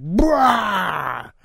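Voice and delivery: put-on sudden peekaboo shout at a baby; jumping out at baby, mock shout; playful shout. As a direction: pop up and yell it. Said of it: "come out and be like".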